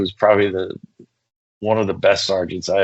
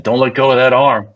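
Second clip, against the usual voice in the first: louder, insistent command; firm order; 4 semitones higher, 6 dB louder than usual. Retold or imitated. Telling his partner to hold the suspect, firm order. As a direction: bark it at your partner.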